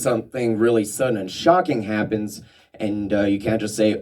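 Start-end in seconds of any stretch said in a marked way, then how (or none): none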